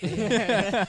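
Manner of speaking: high, loud hammed-up agreement; mock eagerness. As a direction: imitate yourself agreeing far too keenly. Acts out his eager agreement.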